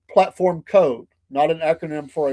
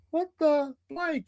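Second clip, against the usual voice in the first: stunned dad; 10 semitones higher, 6 dB quieter, slower than usual. Retold or imitated. Dad discovers damaged trunk.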